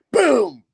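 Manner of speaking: high, loud mock explosion; explosive boom. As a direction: boom it out gleefully. Imitates explosion in villain's taunt.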